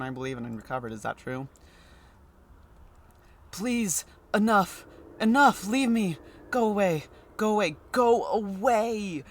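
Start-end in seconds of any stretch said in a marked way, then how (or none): 3.5-7.8 high, loud pleading, go away; frantic man begging the visitor to leave; distressed man behind door. Acted. Man behind the door shouting at the doctor to go away, high and frantic. 7.9-9.2 very slow, high, loud "Go away"; drawn-out plea. Acted. Man behind the door slowly dragging out "Go away" to the doctor.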